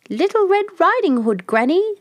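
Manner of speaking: put-on wolf squeaks high, posing as a little girl; wolf faking a child. Wolf at the door pretends to be the child, high and sweet. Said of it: "trying to speak like the child".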